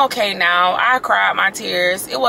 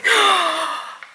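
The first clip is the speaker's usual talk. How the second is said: sharp, high intake of breath; shocked gasp; 10 semitones higher than usual. Real, not acted. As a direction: gasp in real astonishment. Shock at open door.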